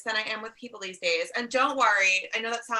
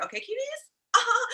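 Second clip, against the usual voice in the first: laughing; 12 semitones higher than usual. Real, not acted. Laughs at own line.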